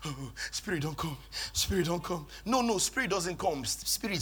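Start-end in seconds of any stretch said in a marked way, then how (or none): 0.0-2.3 mock worship plea. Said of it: "in church during worship like this".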